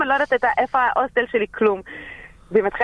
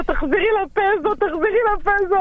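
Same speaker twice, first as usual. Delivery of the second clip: frantic plea; 12 semitones higher than usual. Retold or imitated. Panicked plea to the student.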